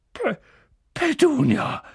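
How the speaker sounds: stammered, high-pitched gasp. Acted. Uncle shocked by the letter, gasping to his wife. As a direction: choke on the name in shock. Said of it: "stieß er keuchend hervor".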